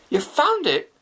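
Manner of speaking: a high, eager, hopeful question; eager excitement. Acted. Shafi on the phone with police, eager and excited. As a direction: jump at the news. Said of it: "he asked excitedly".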